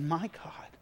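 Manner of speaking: hushed, whispered cry to God; tearful prayer. Retold or imitated. Man praying alone on the highway, tearful whisper. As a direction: whisper it as if starting to weep. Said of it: "But I begin to weep".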